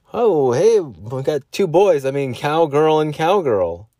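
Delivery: high, drawn-out showman call; hammed-up cowboy announcing the guests slowly; cowboy greeter. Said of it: "dress up as cowboy".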